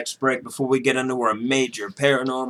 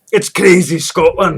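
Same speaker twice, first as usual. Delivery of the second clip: mock Scottish; 4 semitones higher, 4 dB louder, slower than usual. Keeps the fake accent.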